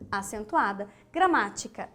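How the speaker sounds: ordinary talk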